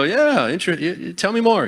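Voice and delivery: mock interest. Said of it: "I go".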